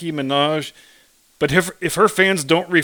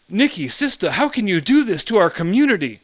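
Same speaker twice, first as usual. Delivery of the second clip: mocking fake street accent, higher pitched; Joy Reid; 3 semitones higher than usual. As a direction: put on a hammed-up street accent, pleading in mock reproach. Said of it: "doing it in this god-awful fake street accent".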